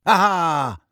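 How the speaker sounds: put-on loud gloating old caretaker; triumphant Filch. A narrator's reading. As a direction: pounce with a loud Aha. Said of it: "said Filch".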